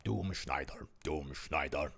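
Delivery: low, slow villain intro; deep dramatic voice. Pretend. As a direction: announce it like a menacing villain. Introducing the German voice.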